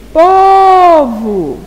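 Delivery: "Povo" called out, PO held long, high and loud; stretched stress on "Povo". Shown as a demonstration. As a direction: call "Povo" with a long, loud first syllable. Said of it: "a sílaba que se estender".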